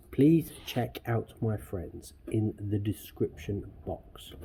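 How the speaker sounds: slow, deliberate, every word placed; careful slow reread of the outro line; slow careful retake. Shown as a demonstration. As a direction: say it slowly so it comes out right this time. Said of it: "So I can say it".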